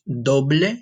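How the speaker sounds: "doble" said with Spanish vowels. Shown as a demonstration. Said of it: "In Spanish".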